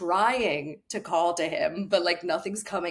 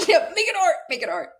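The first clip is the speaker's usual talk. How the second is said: excited agreement; 12 semitones higher, 6 dB louder than usual. Real, not acted.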